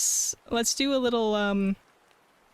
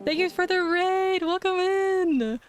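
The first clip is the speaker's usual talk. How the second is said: cute anime streamer; 8 semitones higher than usual. Real, not acted. Thanking raiders.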